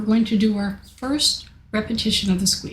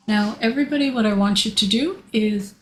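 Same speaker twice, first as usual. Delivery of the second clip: normal talking voice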